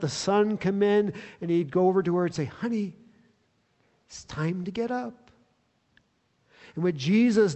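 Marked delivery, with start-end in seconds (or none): 2.4-5.3 soft, tender morning wake-up; whisper-close loving nudge to get up; a father gently waking his little girl; gentle father waking daughter. Retold or imitated. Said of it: "when he walks into his little girl's bedroom".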